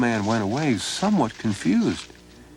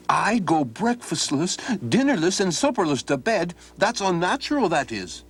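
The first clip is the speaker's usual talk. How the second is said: mild grumbling Miles; hungry lad's complaint; 11 semitones higher than usual. Acted. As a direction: moan about missing meals.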